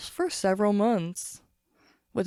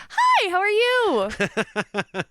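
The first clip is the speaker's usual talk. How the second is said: much higher, slow sweet greeting; customer service voice; 11 semitones higher, 3 dB louder, much slower than usual. Shown as a demonstration. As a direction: put on the fake phone smile. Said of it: "I can fake voice".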